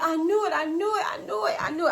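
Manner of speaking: high, giddy replay of relief; so happy. Retold or imitated. Learner driver who found her glasses, so happy. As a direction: chant it high, thrilled and relieved. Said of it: "I was just so happy".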